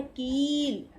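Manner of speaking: कील with a slow, drawn-out long ई; कील said very slowly with a held ई; long ई demo. Shown as a demonstration. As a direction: stretch the ई in कील so it is clearly long. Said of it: "ये बड़ा ई की मात्रा है".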